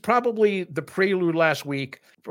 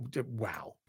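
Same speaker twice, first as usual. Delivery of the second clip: breathless whispered wow; awed whisper; 4 semitones lower, 13 dB quieter than usual. Real, not acted. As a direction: whisper it in amazement. Awed at the comeback.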